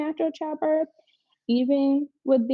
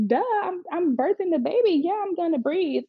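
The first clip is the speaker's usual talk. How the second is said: raised pitch, sassy pushback; mock incredulous retort; 8 semitones higher than usual. Laboring mother answering staff about breathing, mock exasperated. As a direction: state the obvious with playful exasperation.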